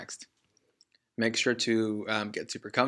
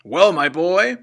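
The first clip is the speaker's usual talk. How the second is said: big booming father voice; loud father's boast; 7 semitones higher, 10 dB louder than usual. Acted. Father booming proudly at his son. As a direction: bellow it like a smug car-dealer dad. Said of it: "addressed his son in a loud voice".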